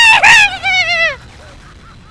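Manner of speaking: loud, high cackle; high shrieking laugh. Real, not acted.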